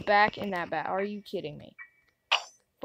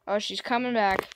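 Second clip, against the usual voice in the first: usual speaking voice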